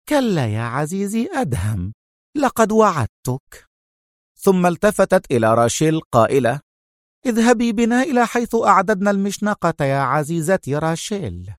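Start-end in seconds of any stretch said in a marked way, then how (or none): 0.1-3.7 mocking. A narrator's reading. Sonia denying Adham any escape, mockingly. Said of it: "ابتسمت في سخرية وهي تقول". 7.3-11.5 high-pitched female villain; Sonia's voice. A narrator's reading. Sonia sending Rachel to the gallows, in high voice.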